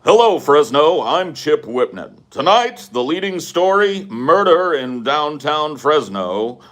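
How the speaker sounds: hammed-up local newscast opening, pitched high; punchy mock anchor with slow, stretched words; TV news anchor. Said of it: "T V news people".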